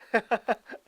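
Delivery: short amused laugh. Real, not acted. Vlogger laughing at Japan's double game, amused laugh. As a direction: laugh at the irony.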